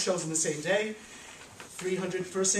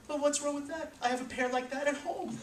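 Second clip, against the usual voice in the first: proud Yetta; 8 semitones higher, 4 dB quieter than usual. Acted. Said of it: "And Yetta said".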